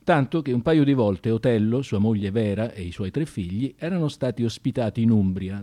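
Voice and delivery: normal talking voice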